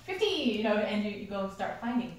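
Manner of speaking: usual speaking voice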